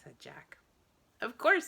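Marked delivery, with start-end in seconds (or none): 1.2-1.7 high, loud eager agreement; cheery. A narrator's reading. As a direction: bounce it back brightly. Cheerful repeated replies.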